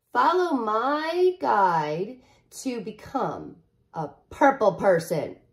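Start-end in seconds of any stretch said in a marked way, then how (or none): 0.1-2.2 drawn-out, slow invitation; slow read-aloud. Acted. Penny invites the reader to follow, slow and drawn out. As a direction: stretch each word out. Slowed storybook line.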